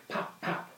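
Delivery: breathy voiceless pops; soft popping lips; whispered pop. Acted. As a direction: pop it without voice like a cork. Cork sound for the baby.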